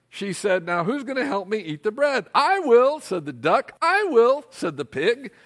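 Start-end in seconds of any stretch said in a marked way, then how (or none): 0.6-2.3 higher, pointed hen question; a hen setting a trap; lightly voiced hen. Hen asking who will eat the bread, higher and pointed. 2.3-3.0 high, loud eager volunteering; a lazy duck suddenly keen; mock eager duck. Duck eagerly claiming the bread, high and loud. 3.8-4.4 high, loud eager volunteering; a lazy duck suddenly keen; mock eager duck. Duck eagerly claiming the bread, high and loud.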